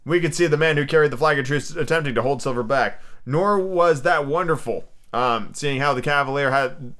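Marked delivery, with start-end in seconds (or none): none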